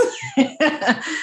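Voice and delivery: light high laugh; warm laugh. Real, not acted. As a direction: laugh along, delighted. Host laughing at shared joy.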